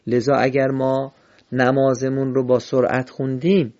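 normal talking voice

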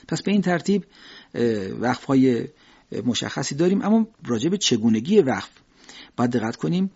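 ordinary talk, nothing marked

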